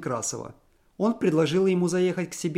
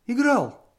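high, louder alarmed question; wife fears the worst; sharp question; 10 semitones higher, 5 dB louder than usual. A narrator's reading. Wife asks husband if he gambled, alarmed.

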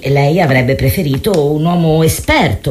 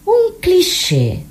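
higher, slow, drawn-out questioning echo; surprised echo; 11 semitones higher, much slower than usual. Real, not acted. Therapist echoing her patient's word, surprised and drawn out. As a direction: stretch the words out in disbelief.

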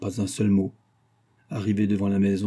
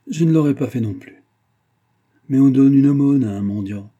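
louder, higher plea; insistent pleading; 4 semitones higher, 8 dB louder than usual. A narrator's reading. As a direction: plead with raised voice. Ruined gambler pleads for alms. (supplication insistante, voix plus forte)

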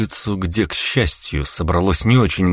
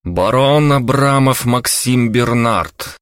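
slow, higher, ringing announcement; loud reading aloud; 5 semitones higher, 3 dB louder, slower than usual. Acted. Count reads passport out to the crowd, loud announcing. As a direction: proclaim the name for all to hear. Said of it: "вслух громко прочитал".

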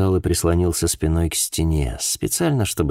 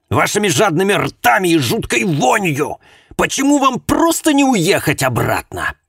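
hateful rant; 12 semitones higher, 5 dB louder than usual. Acted. Hateful mother rants at detective.

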